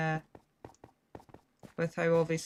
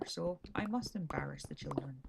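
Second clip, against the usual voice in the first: whisper; 6 semitones lower, 8 dB quieter than usual. Pretend. Mock solemn whispered aside.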